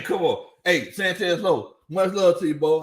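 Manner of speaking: ordinary talk, nothing marked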